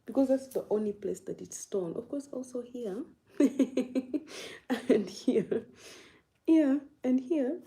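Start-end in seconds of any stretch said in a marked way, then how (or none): 3.4-7.6 giggling, higher and louder; laughing. Real, not acted. Streamer laughs while showing viewers more holes in the shirt. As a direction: laugh through the words.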